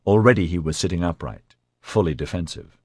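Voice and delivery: ordinary delivery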